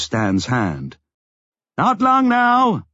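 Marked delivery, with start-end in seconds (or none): 1.8-2.9 slow, high raised voice; calling out. A narrator's reading. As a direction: call slowly over the din. Talk shouted over the quake.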